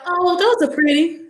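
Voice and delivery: lightly voiced teacher, pitched high; high-pitched imitation of a complimenting teacher; teacher's compliment. Teacher remarking on a student's braids, high mock compliment.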